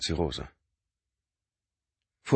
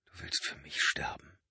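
stunned near-whisper; 2 semitones lower, 8 dB quieter than usual. A narrator's reading. Mark stunned question.

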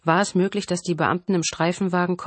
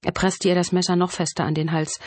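ordinary delivery, nothing marked

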